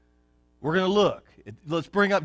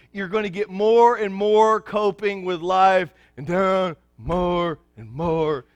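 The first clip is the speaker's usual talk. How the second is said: normal talking voice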